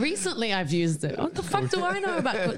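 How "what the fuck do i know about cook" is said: mock outburst. Real, not acted. Self-mocking outburst.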